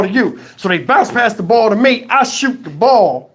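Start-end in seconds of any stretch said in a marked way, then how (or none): none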